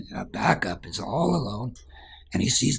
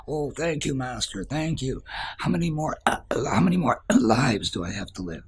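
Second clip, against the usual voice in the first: higher, pleading thanks and question; eager old man; 6 semitones higher than usual. Acted. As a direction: gush thanks, then beg to know.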